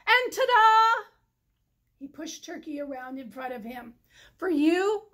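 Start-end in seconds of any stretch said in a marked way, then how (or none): 0.1-1.0 high, loud showman reveal; grand ta-da. Acted. 4.4-5.1 louder presentation; proud offering. Acted. Presenting turkey.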